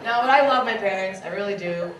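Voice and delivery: ordinary talk, nothing marked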